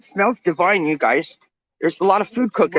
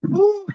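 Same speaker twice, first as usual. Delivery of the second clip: high, blown-out whew; a high whew from feeling overwhelmed; 12 semitones higher than usual. Real, not acted. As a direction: let the air out in a rush. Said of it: "I'm totally overwhelmed".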